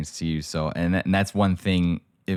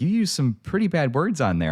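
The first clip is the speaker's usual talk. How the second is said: scolding grandma; 9 semitones higher than usual. Retold or imitated. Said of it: "She's like".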